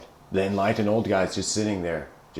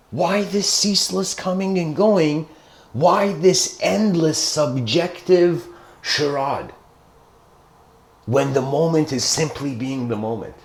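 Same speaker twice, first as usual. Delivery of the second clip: higher, in wonder, slowing on the second question; awed realization; 5 semitones higher than usual. Retold or imitated. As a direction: voice the kid's sudden awakening. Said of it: "This kid's like, "Whoa".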